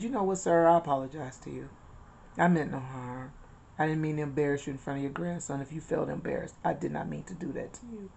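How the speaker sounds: soft apology. Retold or imitated. Retold apology to the old man.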